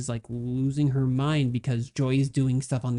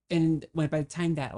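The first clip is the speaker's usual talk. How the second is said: usual speaking voice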